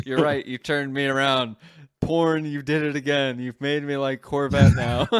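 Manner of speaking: loud laughing surrender, voice up; giddy shout that the Corvette won you over; excited concession. Real, not acted. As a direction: throw your hands up and laugh it off loudly.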